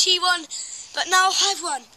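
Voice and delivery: normal talking voice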